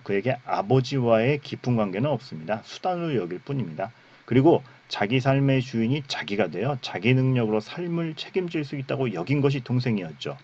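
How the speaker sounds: normal talking voice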